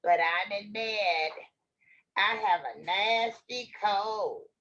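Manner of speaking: sickly granny act, slow and scratchy. Acted. Wolf claims a cold as Grandma, slow scratchy voice. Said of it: "came a scratchy voice".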